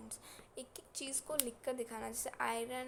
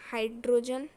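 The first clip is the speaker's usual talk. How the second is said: loud, punched-out element name; emphatic call-out; 13 dB louder than usual. Shown as a demonstration. Teacher pointing out the common thing to students, emphatic and loud. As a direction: call it out loudly so nobody misses it.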